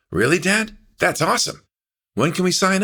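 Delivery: bursting with excitement at the news; excited. Acted. Son thrilled dad agrees to guitar lessons, high and eager. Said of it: "genuinely excited".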